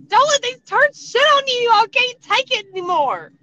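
very high, loud indignant outburst; outraged yell. Retold or imitated. Hairdresser rants for betrayed client, high loud outburst. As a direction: blow up on her behalf.

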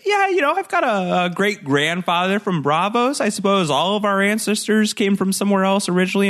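high, oily excuse; smarmy Littlefinger. Retold or imitated. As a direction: slide through the excuse. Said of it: "And he's like".

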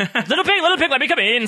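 high, laughing big bad wolf. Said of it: "said the wolf".

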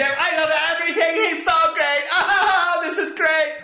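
high, giddy gush with a laugh; hammed-up high-pitched delight; mock gushing bride. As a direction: gush like a besotted newlywed.

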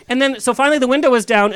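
ordinary delivery

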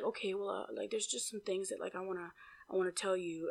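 very soft, low, hesitant mumble; hushed, low, halting impression; imitated mumbling. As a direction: imitate him hedging under his breath. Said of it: "that's how he was talking".

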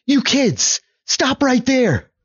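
high-pitched woman's character voice; put-on high voice, halting an intruder; woman calling down.

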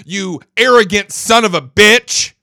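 real fury, voice rising and loud; angry rant. Real, not acted. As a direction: let the anger boil over into shouting. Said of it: "Now, that irritates me".

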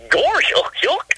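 high, goofy cartoon chuckle voice. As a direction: do a silly cartoon dog guffaw. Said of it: "I can do Goofy".

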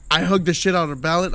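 ordinary delivery

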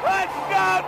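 normal talking voice

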